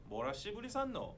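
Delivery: fast Gyeongsang retort; Gyeongsang dialect. Shown as a demonstration. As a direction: rattle it off like a local. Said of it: "it's used like".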